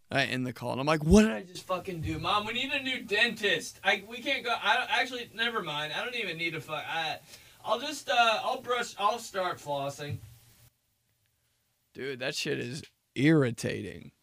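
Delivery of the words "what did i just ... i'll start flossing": higher, softer flustered rambling; flustered kid. Retold or imitated. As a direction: backpedal nervously. Kid flustered after call.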